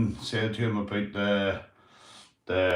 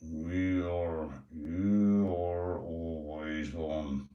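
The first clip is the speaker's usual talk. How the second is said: much lower, much softer, very slow put-on voice; slow pet voice; 4 semitones lower, 5 dB quieter, much slower than usual. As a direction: drawl it out low and quiet like a sleepy pet. Same pet voice replying.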